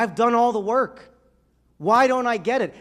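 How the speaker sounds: loud, high exasperated cry; Augustine venting to his friend, exasperated; frustrated outburst. Acted. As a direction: vent it like you've done everything.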